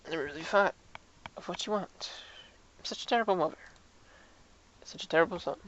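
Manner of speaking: hushed, acted regret; subdued remorse. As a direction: blame yourself quietly, with pauses. Acted remorseful mother and son scene.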